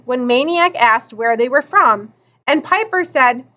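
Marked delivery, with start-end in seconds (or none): none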